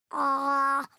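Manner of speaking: long open-throated "aah"; patient's "ah". Acted. Patient obeys the pretend nurse, held "ah". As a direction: hold an "ah" for a check-up. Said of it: "Open wide and say".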